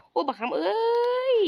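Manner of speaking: high, slow stretched call to a friend; long high excited holler; drawn-out call. Real, not acted. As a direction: stretch the name out high like calling across a field. Calling out to teammate in game.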